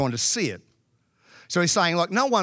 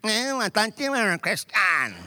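mocking trainee; 3 semitones higher than usual. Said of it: "and he went".

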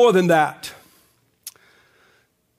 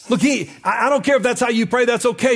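ordinary talk

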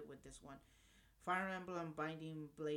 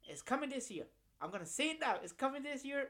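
loud, high excited prediction; excited shout; 8 semitones higher, 7 dB louder than usual. Real, not acted. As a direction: hype it up, nearly yelling.

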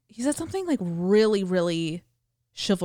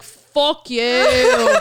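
loud, raised-pitch comic retort; mock defiance; 6 semitones higher, 9 dB louder than usual. Ed answering the angry friend at the door, mock defiant shout. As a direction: throw it back hammed-up and drawn out.

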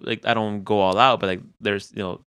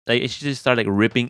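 normal talking voice

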